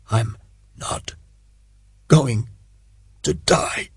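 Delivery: whispered words edged with a sob; soft, near sobbing. A narrator's reading. Wounded Sharp telling Connolly he won't die, whispered near a sob. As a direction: force out each word through the pain. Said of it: "Each word was soft, each almost edged with a sob".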